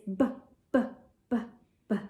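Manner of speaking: b repeated as a short voiced pop; letter B sound. Shown as a demonstration. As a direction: say b, b, b crisply. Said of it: "the B says".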